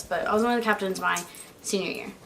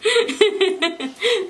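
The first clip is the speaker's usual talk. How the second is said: high, delighted burst of laughter; 11 semitones higher than usual. Real, not acted. Tickled by her friend's offer, laughing. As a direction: break into a happy laugh.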